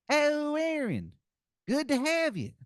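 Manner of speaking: put-on a wizard cabbie hamming up a terrible Philadelphia accent; awful Philadelphia accent. Wizard driver greeting Aaron, hammed-up awful Philadelphia accent. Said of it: "the worst Philadelphia accent".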